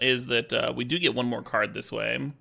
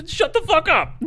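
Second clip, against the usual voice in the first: much higher, louder, laughing shout; hammed-up shout; 7 dB louder than usual. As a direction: yell it back in play. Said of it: "And I'd be like".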